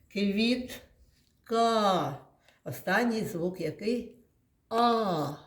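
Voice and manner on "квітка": Квітка drawn out slowly, holding the final а; slow teaching pronunciation. Shown as a demonstration. Teacher sounds out Квітка to find the last sound.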